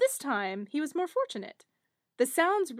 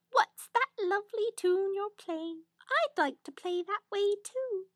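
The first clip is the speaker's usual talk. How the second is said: eager little hare; 8 semitones higher than usual. Acted. Hare voiced in fairy tale.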